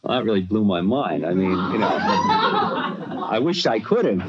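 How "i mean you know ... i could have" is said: laughing. Real, not acted. Laughs recalling the request.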